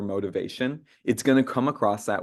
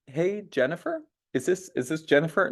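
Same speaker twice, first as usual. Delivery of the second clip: higher, asking as if unsure who answers; the hesitant cold-caller check; unsure questioning; 6 semitones higher than usual. Shown as a demonstration. Trainer mocks the wrong, doubtful cold-call opener. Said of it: "you're not".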